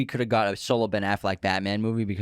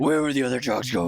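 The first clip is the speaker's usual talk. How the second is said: Batman voice; 2 semitones higher than usual. Retold or imitated. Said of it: "yours is way better".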